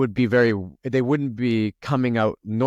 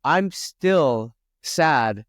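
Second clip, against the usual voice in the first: sad; 5 semitones higher, 4 dB louder, much slower than usual. Real, not acted. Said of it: "I'm so emotional".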